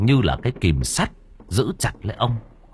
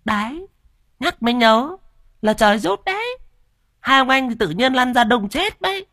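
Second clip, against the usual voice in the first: much higher, smug preening voice; puffed-up boasting; 14 semitones higher than usual. Acted. Cúc boasts to her husband about the land, smug high voice. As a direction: crow about your luck. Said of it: "Mụ Cúc phỏng mũi".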